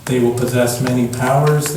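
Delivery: usual speaking voice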